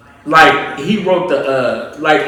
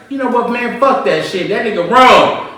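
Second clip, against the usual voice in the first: louder, higher-pitched dismissal; mock indignation; 8 semitones higher, 6 dB louder than usual. Retelling his reaction to a friend's argument, mock indignation.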